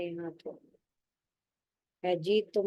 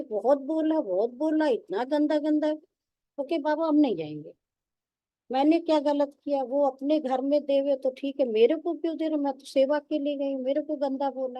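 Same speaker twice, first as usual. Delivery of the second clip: put-on upset young sister; 5 semitones higher than usual. Young sister complaining to Baba about the husband's abuse, high and aggrieved. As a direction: whine it to Baba like a hurt girl.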